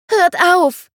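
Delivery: put-on high, loud child's plea; a small girl begging the fighters to stop; pleading child. A narrator's reading. The child begging the fighting men to stop: high pleading cry. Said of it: "flehte es".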